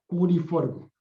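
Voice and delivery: ordinary talk, nothing marked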